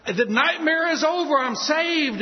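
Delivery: high, loud joyful cry; overjoyed shout. Retold or imitated. Preacher the night he got saved, high joyful shout. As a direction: shout your relief. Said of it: "I said".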